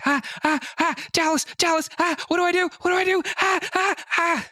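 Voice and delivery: high, frantic mock panic. New player panicking in Minecraft, high mock panic. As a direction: squeal for help in a panic. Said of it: "steady loop of me going".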